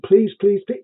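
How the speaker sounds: lightly acted, urgent begging; mock begging. Players begging their god to destroy the world, mock pleading. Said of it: "they can be all begging".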